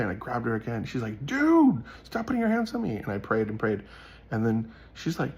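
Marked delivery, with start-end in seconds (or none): 1.2-3.0 very high mock complaint, loud first word; imitated indignant protest. Retelling the girl who is grabbed again, high indignant protest.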